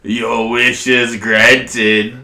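slow, drawn-out dragon decree. Acted. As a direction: grant the wish like a godlike dragon. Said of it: "massive dragon".

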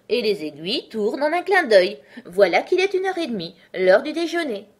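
loud, animated character voice; Hatter explaining. Acted. Hatter tells Alice how Time works, loud and animated. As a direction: explain with emphatic enthusiasm.

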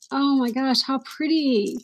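usual speaking voice

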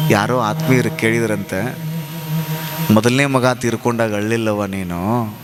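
low, grave tale of grief; solemn storytelling. A narrator's reading. Preacher telling of a bereaved mother's weeping, solemn. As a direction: let each phrase sink, low and heavy.

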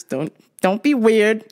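ordinary talk

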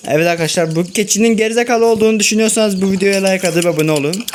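fast, bright channel-plug read; youtuber plug. Acted. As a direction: rattle off the like-and-subscribe ask. (hızlı youtuber abone ol çağrısı)

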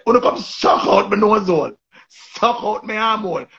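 hammed-up crude cuss; mock cuss again. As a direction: sing the insult out mockingly. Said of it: "she just say".

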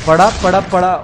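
loud, high, rapid callout of the knockdown; real excitement; excited callout. Real, not acted. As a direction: shout the kill as it happens. Enemy knocked down.

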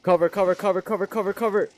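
high, loud urgent call for cover; shouting orders over battle. Real, not acted. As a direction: yell for cover. Zombies coming, calls cover.

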